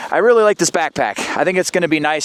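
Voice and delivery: ordinary talk, nothing marked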